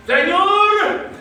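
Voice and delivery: high, stretched cry of protest; mock complaint. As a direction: wail back at God for comic effect. Mock retort to God, audience laughing.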